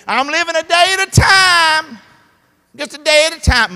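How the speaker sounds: loud, high mock quote; mocking the complacent. Preacher mocking people who say it. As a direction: shout the excuse high, mocking. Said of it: "They say this".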